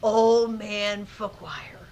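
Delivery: put-on slow, drawn-out "Old Man Fookwire"; dramatic reveal. Shown as a demonstration. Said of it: "It was kind of dramatic effect".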